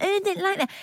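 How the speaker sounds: put-on high, fussy kid voice; whiny child voice. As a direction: whine like a picky child. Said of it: "my children are a bit like that".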